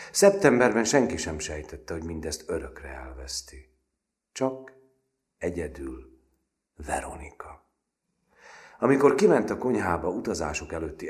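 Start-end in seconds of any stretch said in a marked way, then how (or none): none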